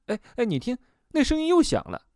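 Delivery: high-pitched, urgent heads-up; startled alert. Acted. Patient alerting the therapist to the dripping noise, high and startled. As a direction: call attention to a sudden sound.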